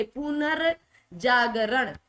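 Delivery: पुनर्जागरण stretched in parts, pu-nar jaa-ga-ran; slow sounding-out. Shown as a demonstration. Teacher shows how to write it, slow syllabled पुनर्जागरण. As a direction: draw out पुनर्जागरण piece by piece. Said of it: "पहले पुना लिख दो".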